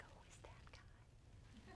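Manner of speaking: breathless whispered question; hushed amazed whisper; awed whisper. Retold or imitated. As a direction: whisper it as if stunned. Asking assistant about stranger.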